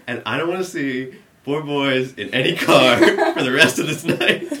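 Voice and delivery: loud, high cop ultimatum; mock warning. Cop warning the boys off, loud exasperated voice. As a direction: lay down the law loudly, half laughing.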